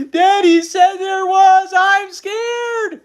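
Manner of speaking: high, loud, drawn-out squeal; frightened squeal. A narrator's reading. Mother ignoring her daughter's question, squealing in fright. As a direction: wail it in hammed-up terror. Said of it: "continued to squeal".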